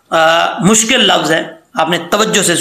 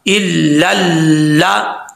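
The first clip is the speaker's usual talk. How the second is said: drawn-out recited word; slow recitation; much slower than usual. Shown as a demonstration. Teacher joins the letters, reciting slowly. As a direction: stretch it slowly. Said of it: "लाम ज़बर ला".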